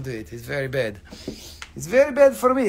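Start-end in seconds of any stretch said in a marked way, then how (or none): 1.8-2.7 funny voice. Pretend. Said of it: "you can't hear my funny voice".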